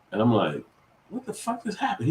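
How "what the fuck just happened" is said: high, stunned outcry; shocked disbelief. Retold or imitated. Father to his just-woken son, high and stunned. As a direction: blurt it out in shock. Son wakes after fainting.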